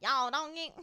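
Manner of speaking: loud, broad mock country "Y'all"; exaggerated country twang. Shown as a demonstration. Host mocking how she doesn't say it, broad country. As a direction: ham up the country accent. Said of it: "I'm not like".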